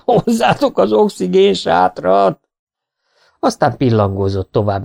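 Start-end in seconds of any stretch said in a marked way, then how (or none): 0.0-2.4 high, slow bawl for rescue; bellowed plea. A narrator's reading. Exhausted swimmer demands oxygen from shore, bellowing. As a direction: bellow across the pool. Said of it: "bömbölt Faragó a medence közepéről".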